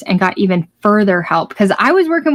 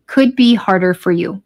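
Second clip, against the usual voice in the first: ordinary talk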